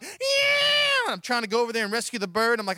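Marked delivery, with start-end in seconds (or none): 0.0-1.0 very high, loud yell; wild shout. Retold or imitated. Throwing his hat at the birds, very high loud shout. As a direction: shriek at the swooping birds.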